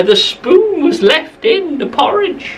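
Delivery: ordinary delivery, nothing marked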